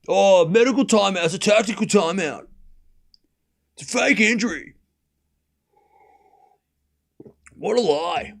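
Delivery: mock-indignant fan in a put-on accent; lightly hammed-up accent, scoffing at a supposed scam; mocking complainer accent. Streamer parodying complaints about medical timeouts, mock outrage in accent. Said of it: "remember that accent and have a laugh".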